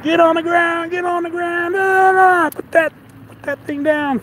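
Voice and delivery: high mock police shout; retold cop yelling at him to get down; imitating police. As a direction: bark orders like a cop, hammed up. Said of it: "cop cars".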